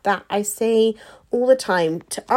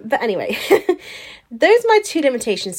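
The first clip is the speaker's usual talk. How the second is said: ordinary talk, nothing marked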